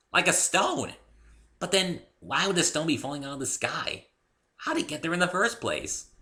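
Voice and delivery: bubbly pony. A narrator's reading. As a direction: bounce along like a giddy pony. Pony rambling on tangent.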